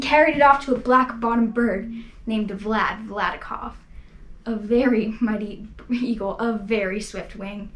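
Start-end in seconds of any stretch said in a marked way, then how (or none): none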